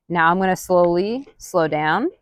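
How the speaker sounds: usual speaking voice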